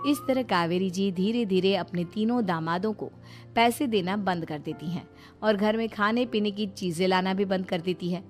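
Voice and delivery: ordinary delivery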